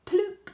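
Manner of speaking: plop sound. A narrator's reading. Frog jumps into pond.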